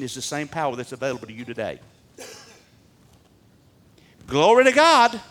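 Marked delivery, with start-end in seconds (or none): 4.3-5.2 high, loud cry of praise; exultant praise shout. Real, not acted. Preacher praising God before his congregation, exultant shout.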